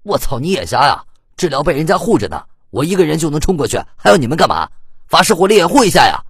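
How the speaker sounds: loud, fast angry yell building up; cover me shout. Acted. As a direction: snap back and build to a shout. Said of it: "柔道玩家解释之余".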